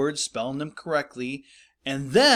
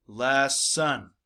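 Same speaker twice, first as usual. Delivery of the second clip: lesson drawn out as LESS... SON; syllable by syllable. Shown as a demonstration. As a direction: split lesson into two full syllables. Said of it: "You spell less".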